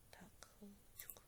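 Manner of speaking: barely audible whispered aside; hushed, voiceless hold-on aside; real whisper. Real, not acted. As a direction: whisper it to yourself. Murmurs to self while busy.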